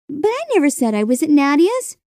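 put-on girl putting her father right; protesting girl. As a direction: explain it insistently, voice lifted high.

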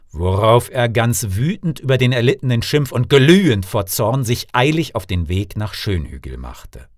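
plain reading voice

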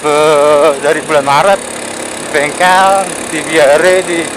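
ordinary talk

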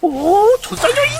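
very high, loud squeal; excited shout. Pretend. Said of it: "다시 해봐". (아주 높게 외치는 소리)

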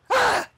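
sudden high loud cry; startled donkey yelp. Retold or imitated.

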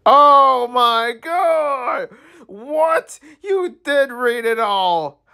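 high, drawn-out delighted shock; shocked squeal. Real, not acted. Streamer learning the boy read it all, shocked squeal. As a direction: gasp it out, scandalised and thrilled.

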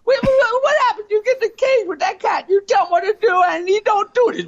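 very high, fast, alarmed; panicky friend. Acted. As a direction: fire the questions in a squeak.

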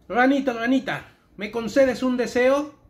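llama a la rana fuerte y lento; invocación en voz alta. Acted. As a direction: recita las palabras mágicas en voz alta y más aguda. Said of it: "las palabras mágicas". (conjuro en voz alta, más agudo y lento)

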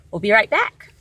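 normal talking voice

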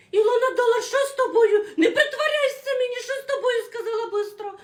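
put-on panicked old woman. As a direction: panic over the daughter-in-law. Mother-in-law scared, wife in labour. (висока, налякана стара свекруха)

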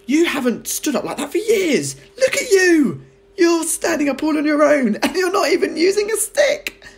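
high, loud, laughing amazement; excited laughing boy. A narrator's reading. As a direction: cheer her on with a laugh. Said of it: "George cried".

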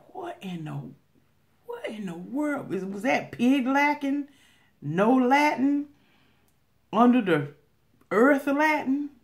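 high, slow baffled wondering; bewildered thinking. Retold or imitated. An eavesdropper in line, baffled by strangers' speech, high and slow. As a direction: drag out the confusion, slowly. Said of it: "you be thinking to yourself".

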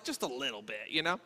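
ordinary delivery

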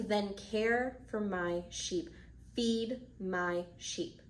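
very slow, measured words; calm and deliberate, each word set apart; solemn charge. Acted. Jesus gives Peter his task, slowly and solemnly.

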